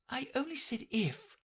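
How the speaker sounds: high, piteous plea; pleading. A narrator's reading. Alice pleading with the queens in a high piteous voice. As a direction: plead pitifully. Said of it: "poor Alice pleaded in a piteous tone".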